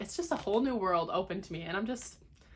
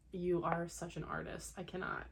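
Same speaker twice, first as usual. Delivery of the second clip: ordinary talk, nothing marked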